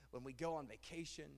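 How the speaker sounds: ordinary talk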